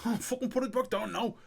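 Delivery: high-pitched urgent demand; panicked order. Acted. [name]'s character ordering his mate to put it back, alarmed. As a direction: push it out high, playing alarmed.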